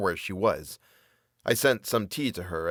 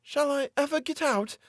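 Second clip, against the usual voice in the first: high, pitiful woman's question. A narrator's reading. Captive woman asking her jailer if she'll ever leave, pitiful. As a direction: ask it pitifully. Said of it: "she inquired in a pitiful tone".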